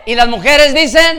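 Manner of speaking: loud, high call for a response; shouted call. Real, not acted. Preacher prompting the women for an amen, shouted call. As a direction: shout it out to rouse the room.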